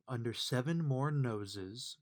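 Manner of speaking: usual speaking voice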